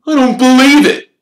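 loud, high cry of disbelief; outraged, high-pitched exclamation. A narrator's reading. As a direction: burst out in outrage. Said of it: "Wood hissed in outrage".

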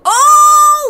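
loud high burst of surprise; surprised shout. Real, not acted. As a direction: blurt it out, thrilled. Discovers frog breeds.

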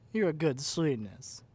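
loud, slow, gushing "good sweetness" like praising a dog; doting dog-owner praise, slow and loud; loud pet praise. Acted. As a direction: beam it at them like a proud pet owner. Praising captive like a puppy.

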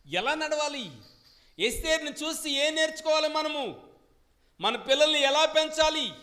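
high, loud preaching to the congregation; full-force preaching. Acted. Preacher pressing the congregation, full-force preaching. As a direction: thunder the questions out from the pulpit.

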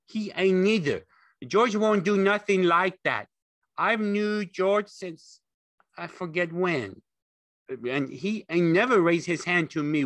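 high, loud, stubborn protest; belligerent retort. A narrator's reading. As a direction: argue back like an upset simpleton. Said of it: "Lenny retorted belligerently".

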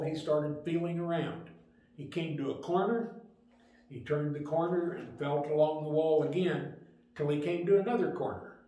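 ordinary delivery

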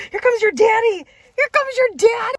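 high, loud puppy-hype voice; excited announcing. Real, not acted. Telling the puppy daddy is coming, loud excited squeal. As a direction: whip the puppy up with glee.

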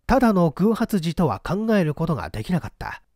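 usual speaking voice

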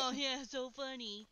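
slow, dry sarcasm; sarcastic. Real, not acted. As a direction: drag it out, not amused at all. Fiance didn't tell her mic off.

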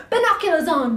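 usual speaking voice

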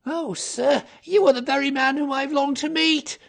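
very high, loud, fervent outburst; excited cry. A narrator's reading. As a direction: greet a rescuer with desperate eagerness. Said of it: "cried the little fellow".

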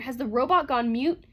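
loud, high, exasperated demand; blurted outburst. A narrator's reading. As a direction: berate the mute robot. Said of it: "blurted Bogart".

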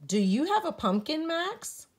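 rising eager question to Max; Spook asking. A narrator's reading. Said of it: "asks Spook".

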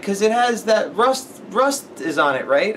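put-on high, slow, earnest little kid. Retelling how the kid described the movie, in a high slow child voice. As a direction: talk like a small boy explaining a movie.

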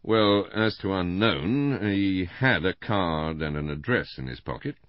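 mildly deprecating correction, opening slowly. A narrator's reading. Inspector correcting a gossip about the victim, mildly deprecating. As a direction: gently play it down, stretching the first words. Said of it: "said in a deprecating voice".

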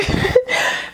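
a high airless laugh through tears; a short airless laugh at her own tears; breathy teary laugh. Real, not acted. Reader moved to tears, high airless laugh. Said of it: "I'm gonna cry".

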